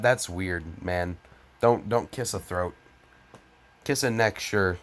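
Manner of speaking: ordinary talk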